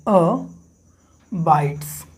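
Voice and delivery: "अ bytes" in two parts, a short अ, a pause, then bytes; split to show stress. Shown as a demonstration. Teacher showing students the pattern, "अ bytes" split at the syllable break. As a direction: separate the unstressed अ from a falling bytes. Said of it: "abide में देखिए".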